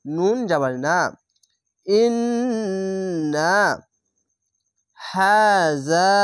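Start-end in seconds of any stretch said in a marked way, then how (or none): 1.9-6.1 slow chanted ইন্না হাজা, long drawn vowels; Quran recitation. Shown as a demonstration. Teacher recites the verse for the class, slow chant. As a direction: intone ইন্না হাজা like tilawat. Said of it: "খার জাবার এক আলিফ টেনে পড়বেন".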